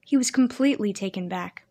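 ordinary delivery, nothing marked

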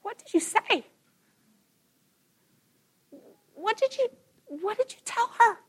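put-on tearful boy's question. Twelve-year-old son asking his mom, hurt and tearful. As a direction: voice the son's tearful confusion. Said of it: "he looked at me with tears in his eyes".

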